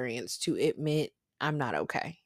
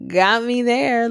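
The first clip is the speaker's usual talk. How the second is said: louder, slower, laughing repeat of "Got me there"; "Got me there" drawn out with a laugh, higher and louder; laughing concession; 7 semitones higher, 9 dB louder, slower than usual. Retold or imitated.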